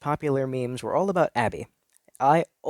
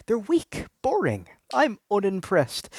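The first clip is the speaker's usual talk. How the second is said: scornful dismissal; 9 semitones higher, slower than usual. Acted. Mocking ordinary women.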